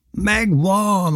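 high, drawn-out cry of success; triumphant yell. A narrator's reading. Boy yelling that the trout is caught, high triumphant cry. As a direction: call it out high, exulting. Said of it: "ordítottam".

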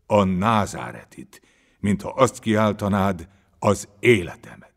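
loud, emphatic, punched phrases; rousing exhortation. A narrator's reading. Master tells follower how to cry for the Nazarene, forcefully. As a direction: urge it with full lungs.

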